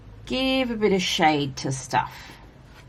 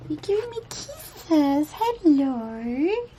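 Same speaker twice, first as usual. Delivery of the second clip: cat voice; 10 semitones higher, slower than usual. Real, not acted. Owner cooing at cat licking her hand, high cat voice. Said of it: "That's my cat voice".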